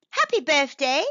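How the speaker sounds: loud, high birthday greeting; delighted cry. A narrator's reading. Mouse hearing it's the bee's birthday too, crying out. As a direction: cry it out to a new friend. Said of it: "cried Mallow".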